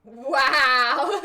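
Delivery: loud, high laughing wow; a burst of surprised delight. Real, not acted. Reacting to a kind comment.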